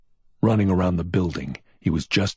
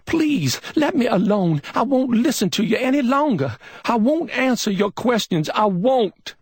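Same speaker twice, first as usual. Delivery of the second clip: high, loud scream; 5 dB louder than usual. A narrator's reading. Billy screams at McMain to leave her alone, loud and high. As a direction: scream at him to stop. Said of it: "She screamed suddenly".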